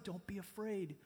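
calm a frightened friend; reassuring voice. Acted. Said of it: "the reassuring voice of Mark".

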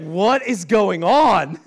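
imitated loud, slow demand; loud bewilderment. Guest of honor confused by her hosts, loudly bewildered.

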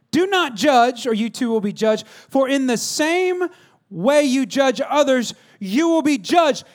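high, slow preacher's declamation; ringing, deliberate pulpit delivery; forceful scripture proclamation. Acted. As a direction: proclaim each phrase with weight.

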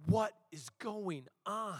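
acted stunned question; retold bewilderment. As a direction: draw it out, baffled. Acting out shock at blood.

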